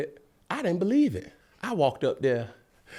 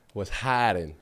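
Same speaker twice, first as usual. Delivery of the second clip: normal talking voice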